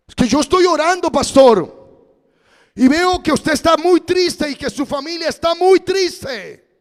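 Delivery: high, loud, urgent voice of a worried church brother; worried brother calling the pastor, high and insistent; anxious caller. Retold or imitated. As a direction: sound like a fretting caller pressing the pastor. Brother tells of his prayer.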